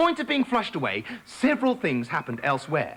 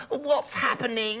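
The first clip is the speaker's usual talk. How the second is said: slow, high sleepy little frog voice; a drowsy frog just woken; 6 semitones higher, slower than usual. Acted.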